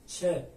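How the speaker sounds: چه as one ch syllable; ch sound. Shown as a demonstration. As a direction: say چه with ch as in check. («چه» با صدای چ)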